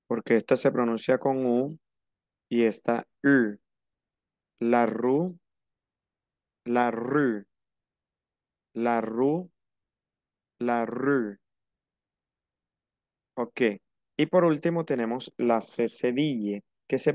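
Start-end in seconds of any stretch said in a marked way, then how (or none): 4.6-11.4 la rue repeated with a French ü. Shown as a demonstration. Teacher drilling the French vowel: la rue repeated. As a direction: drill la rue, lips rounded each time. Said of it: "Hay diferencia en la pronunciación".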